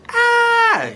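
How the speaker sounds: short, very high acted scream. A narrator's reading. The mayor as George crashes into the dishes, high scream. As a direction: let out a startled high shriek for the story. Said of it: "And the mayor screamed".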